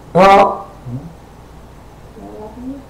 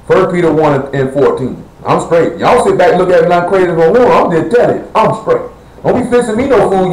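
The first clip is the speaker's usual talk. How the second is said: ordinary talk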